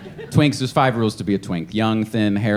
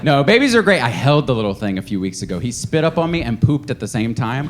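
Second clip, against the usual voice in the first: ordinary delivery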